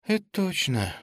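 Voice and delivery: high-pitched, melancholy agreement; wistful. A narrator's reading. Girl agreeing about missing Petrov, wistful. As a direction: agree with a pang of longing. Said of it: "с тоской сказала девушка".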